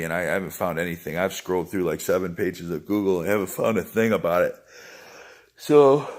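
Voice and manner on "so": word stretched by a yawn. Real, not acted. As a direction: say it mid-yawn. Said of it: "I'm just yawning".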